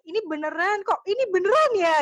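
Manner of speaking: disbelief. Retold or imitated. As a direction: replay the moment you couldn't believe it was real. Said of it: "kayak nggak percaya".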